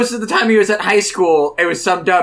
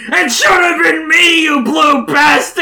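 sobbing, screaming grief; anguished shout; 12 semitones higher, 7 dB louder than usual. Acted. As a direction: wail it out in mock despair.

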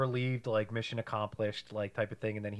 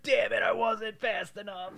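higher, exasperated groan-voice; mock frustrated character; 9 semitones higher than usual. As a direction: curse your bad luck. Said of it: "He's like".

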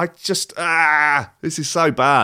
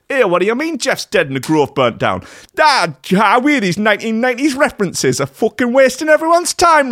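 hammed-up posh voice; 10 semitones higher than usual. Staying in the mocking voice.

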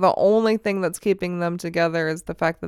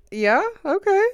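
sarcastic; 12 semitones higher than usual. Retold or imitated. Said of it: "And I'm like".

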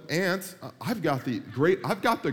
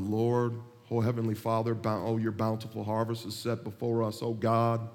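long-winded family pray-er; 7 semitones lower than usual. Acted. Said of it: "And he starts off with".

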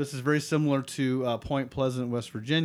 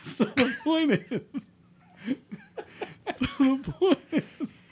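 cracking up mid-sentence; laughing; 12 semitones higher than usual. Real, not acted. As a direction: try to talk through laughter.

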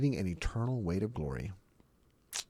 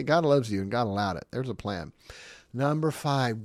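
normal talking voice

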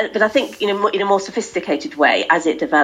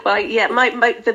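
ordinary delivery

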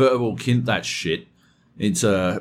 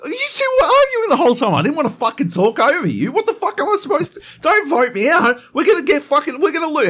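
much higher, louder, fast desperate pleading; frantic protest; 5 dB louder, faster than usual. Retold or imitated. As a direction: plead against the vote in a panic. Retelling panicked protest.

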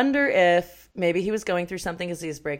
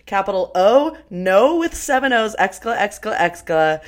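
loud mock horror; 3 semitones higher, 7 dB louder than usual. Host reads her notes on the bad accent, in loud mock horror. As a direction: read out loudly in exaggerated dismay.

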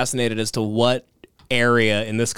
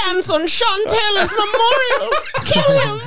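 high squeaky put-on dictator; mock Hitler voice. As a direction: squeal the order to kill, laughing.

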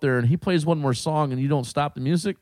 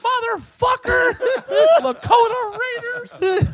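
very high, drawn-out mock shout; hammed-up slow screech of outrage; imitated mother's yell; 5 dB louder, slower than usual. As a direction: stretch it out like an irate woman screaming. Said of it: "You can hear her".

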